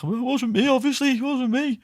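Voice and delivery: put-on high, squeaky voice of a guilty kid; innocent protest. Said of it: "I was like".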